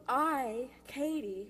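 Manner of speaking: usual speaking voice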